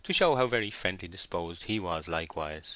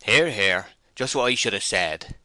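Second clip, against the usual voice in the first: loud, hearty agreement; cried out; 3 semitones higher, 8 dB louder than usual. A narrator's reading. Mark Clark backing the shepherd's threat, crying out. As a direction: cheer him on loudly. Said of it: "and Mark Clark cried".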